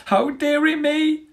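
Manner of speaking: put-on very high startled old rat; a squeaky yelp of dismay; flustered rat. A narrator's reading. Rat knocked flying.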